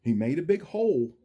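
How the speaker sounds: lively storybook read, big rises and falls; reading to children. A narrator's reading. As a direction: read it like story time for little kids. Teacher reads Seuss book aloud.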